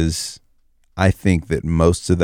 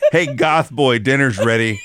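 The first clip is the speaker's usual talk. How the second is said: laughing parent calling, a bit higher; calling kids to dinner; 6 semitones higher than usual. Pretend. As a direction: call him in for dinner, laughing. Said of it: "you just open the cardboard door".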